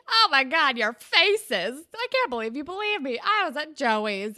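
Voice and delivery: high, loud mock-gleeful crowing; gleeful prankster. [name] to his fooled friends after a prank, gleeful mockery. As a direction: crow at the friends you just fooled.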